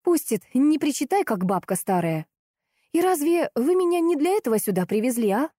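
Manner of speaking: high-pitched combative retort; worked up again. A narrator's reading. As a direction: snap back, getting worked up. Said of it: "Снова завелась Лиза". (снова заводясь)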